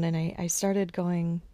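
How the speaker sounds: ordinary delivery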